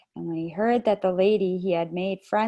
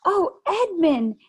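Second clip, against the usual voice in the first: higher, louder, drawn-out delighted cry; crying out with joy; 11 semitones higher, 6 dB louder, slower than usual. A narrator's reading. Lucy spotting her brother in the wood, delighted cry. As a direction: call his name in sheer delight. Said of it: "she cried".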